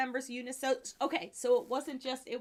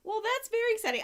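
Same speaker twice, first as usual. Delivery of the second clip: excited; 10 semitones higher, 4 dB louder, faster than usual. Real, not acted. Laughing after name.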